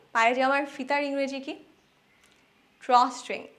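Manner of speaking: ordinary delivery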